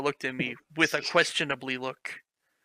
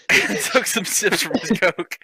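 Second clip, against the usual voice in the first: laughing; 7 semitones higher, 7 dB louder than usual. Real, not acted. Laughing through reading.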